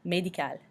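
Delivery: Médical as may-dee-KAL, softly joined up; French pronunciation. Shown as a demonstration. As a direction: say Médical the French way, final L sounded. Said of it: "we do say the L at the end".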